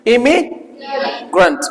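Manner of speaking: Immigrant drawn out slowly with a clear ए start; slow model pronunciation. Shown as a demonstration. Teacher models the ए sound for students, slowly. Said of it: "ये क्या है".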